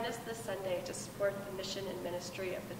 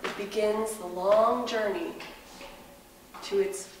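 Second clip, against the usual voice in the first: hushed wildlife documentary narrator; nature documentary; 4 dB louder than usual. Acted.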